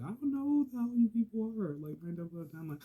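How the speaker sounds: high, loud indignant rant; shrill-pitched venting at people who won't stop; exasperated outburst. Acted. Inmate telling off noisy cellmates, exasperated.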